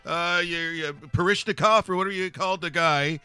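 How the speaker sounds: high, loud, blustering hockey commentator voice; ranting hockey pundit character. Acted. Pundit tells panel who'll be a great player, ranting character voice. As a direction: bluster on and let nobody interrupt.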